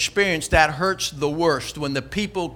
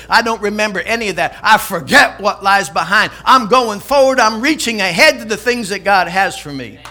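loud, emphatic preacher's declaration; resolute Paul; 8 dB louder than usual. Acted. Paul shaking off his past, loudly resolute. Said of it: "Paul said".

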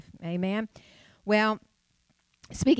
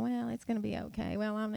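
complacent shrug; 7 dB quieter than usual. Retold or imitated. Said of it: "this sense of".

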